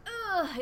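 loud, high effort grunt; lifting grunt. Retold or imitated. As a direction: grunt as you hoist the child. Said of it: "making all these grunting noises".

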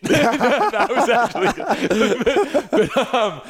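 bursting into laughter telling the story; laughing. Real, not acted. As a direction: crack up mid-sentence, drawn out. Laughing at his own story.

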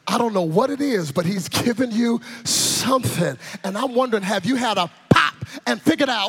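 normal talking voice

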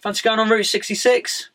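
quick, high, eager offer from a mate; eager invitation. Retold or imitated. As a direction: pitch the road trip like a keen mate. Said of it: "he's like".

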